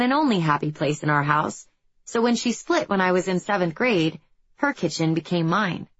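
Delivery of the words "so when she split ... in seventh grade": usual speaking voice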